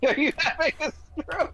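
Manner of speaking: laughing high-pitched repeat of the question. Real, not acted. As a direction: squeal it out through laughter.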